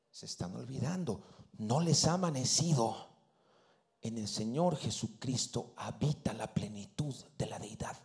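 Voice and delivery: low, breathy hush that fades out; dramatic whisper. Acted. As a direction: whisper it like a secret revelation. Susurro dramático en la predicación. (susurro dramático)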